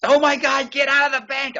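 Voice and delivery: very high, loud panicked cry; frantic panic. Retold or imitated. Girlfriend hears they're verifying the check, loud high panic.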